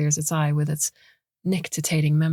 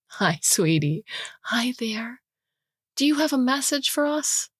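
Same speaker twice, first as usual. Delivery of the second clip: higher, sweet pet-talk; cooing to bird; 7 semitones higher than usual. Acted. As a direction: coax the bird gently. Cooing to the macaw.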